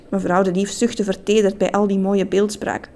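ordinary delivery

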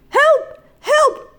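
very high, short cries; panicked cry for help. Acted. Man falling down the shaft, high panicked cries. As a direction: call out in alarm, high-pitched.

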